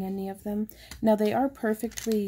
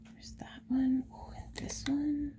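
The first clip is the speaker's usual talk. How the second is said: whisper; 7 semitones higher, 5 dB quieter than usual. Real, not acted. Whispering while showing stones.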